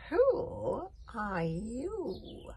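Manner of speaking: very slow drawn-out question; slow eerie witch voice. A narrator's reading. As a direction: stretch every word like an old witch. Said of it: "repeated the voice".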